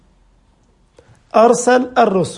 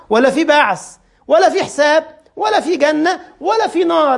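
rising higher on each ولا; building list; 6 semitones higher than usual. Real, not acted. The preacher listing what the atheist denies, building higher. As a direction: climb in pitch on every ولا.

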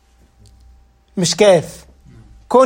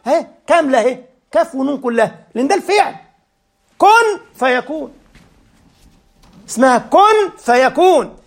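ordinary delivery

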